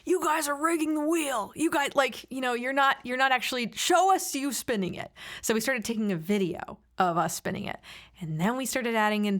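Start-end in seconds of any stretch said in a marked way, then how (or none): 0.0-5.1 higher-pitched imitation of an accusing friend; mock accusation. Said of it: "a friend of ours was like".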